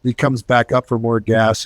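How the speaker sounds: usual speaking voice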